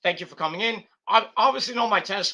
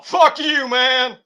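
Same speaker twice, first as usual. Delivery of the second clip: louder, higher "fuck you, man" thrown at haters; defiant sign-off; 7 semitones higher, 9 dB louder, much slower than usual. Real, not acted. Vlogger telling off viewers who don't like him, loud and defiant. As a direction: spit it out at the haters.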